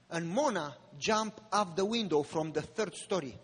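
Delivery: ordinary talk, nothing marked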